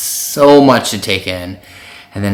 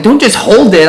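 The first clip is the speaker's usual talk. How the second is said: high, loud retold shout; exasperated yell, pitched way up; urgent scolding; 11 semitones higher, 11 dB louder than usual. Boyfriend scolding guys holding the Marry Me sign, urgent shout.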